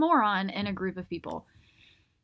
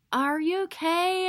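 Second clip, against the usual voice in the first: high, slow, lightly voiced concerned cop; mildly acted concern, higher and slower; 9 semitones higher, slower than usual. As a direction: check on a struggling cyclist, pitched up and drawn out. Cop checking on struggling rider.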